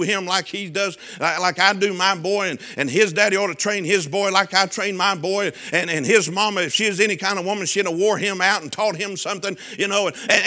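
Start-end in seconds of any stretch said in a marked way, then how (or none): none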